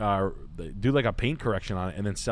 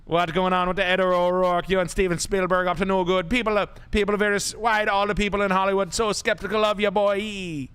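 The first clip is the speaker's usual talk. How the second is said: raised, higher patois voice; hammed-up Jamaican patois, louder; high mock patois rant; 7 semitones higher, 4 dB louder than usual. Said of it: "[name] impression".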